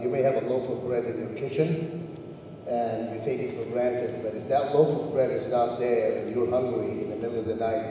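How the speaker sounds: ordinary talk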